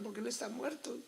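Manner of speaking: retold tearful child. Crying boy answers the priest, mock tearful. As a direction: mildly imitate a child upset and crying. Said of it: "me ponía a llorar solito ahí".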